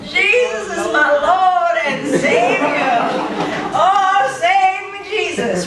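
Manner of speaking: half-sung mock worship; devout churchgoer. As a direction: ham up a weepy true believer. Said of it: "weeping commitment to Jesus".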